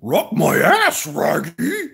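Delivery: high, loud cartoon dog voice, slowed; Scooby-Doo voice. Streamer doing Scooby-Doo for chat, loud and high.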